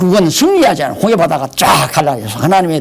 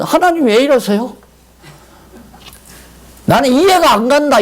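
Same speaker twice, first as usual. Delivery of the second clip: resentful complaint; 9 semitones higher than usual. Retold or imitated. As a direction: grumble you don't understand God. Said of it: "그러면 안 되죠". (불평하는 투정)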